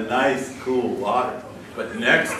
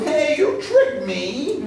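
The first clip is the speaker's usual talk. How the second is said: mock indignant surprise; 10 semitones higher, much slower than usual. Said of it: "And the fox said".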